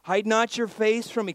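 loud, high plea to God; David's fervent prayer. Acted. David pleads with God not to hide, fervent prayer. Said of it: "Can you imagine him praying this?".

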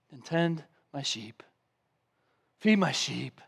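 soft, low tender command; gentle charge. Retold or imitated. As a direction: entrust him quietly.

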